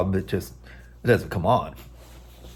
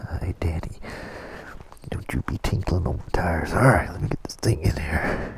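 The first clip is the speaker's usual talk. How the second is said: soft whisper to the dog; hushed words to the dog; 6 semitones lower, 10 dB quieter than usual. Real, not acted. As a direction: whisper so as not to spook him.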